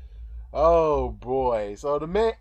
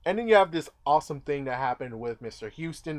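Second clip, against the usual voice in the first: ordinary talk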